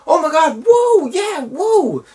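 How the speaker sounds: high, hammed-up whoop of joy; mock the soldier cheering like a fan. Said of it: "He's going".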